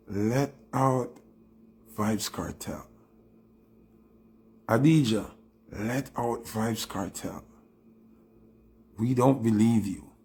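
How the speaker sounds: normal talking voice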